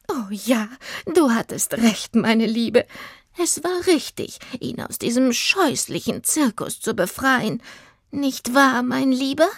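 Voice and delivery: high-voiced doting old twin; gushing older lady. Old lady to her sister and the elephant, doting.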